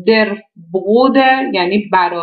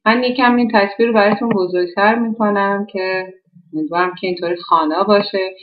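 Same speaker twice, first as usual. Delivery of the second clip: ordinary talk, nothing marked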